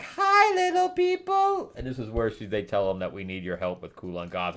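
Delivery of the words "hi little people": very high cheery giant greeting; jolly giant. Retold or imitated. Giant Ymir greeting the little heroes, very high. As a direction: greet the tiny people from far above. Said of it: "he's still like".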